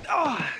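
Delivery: sudden high yelp of surprise and pain; startled yelp. Acted. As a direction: yelp as something hits you. Hit by a ball unexpectedly.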